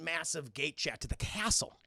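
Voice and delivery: ordinary delivery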